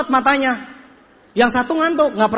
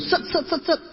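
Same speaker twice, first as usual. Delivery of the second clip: high, quick, softer syllables; imitated patter; 5 semitones higher, 4 dB quieter than usual. Speaker showing how he used to present, high quick patter. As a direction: rattle off short high bursts. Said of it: "saya ngomong kayak begini".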